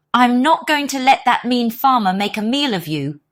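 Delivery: a donkey standing up for its friend; indignant donkey. Acted. Donkey vows to protect rooster.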